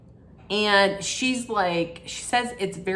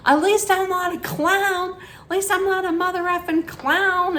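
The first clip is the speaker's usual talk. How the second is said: high, shrill-free mock yell, much higher; imitating a screaming fight; 11 semitones higher than usual. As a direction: shout it like a furious reality star. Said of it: "just yelling and screaming".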